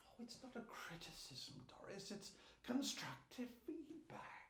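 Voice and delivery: very soft, half-whispered reassurance; smooth it over under your breath; hushed placating. Acted. Placating offended wardrobe member Doris.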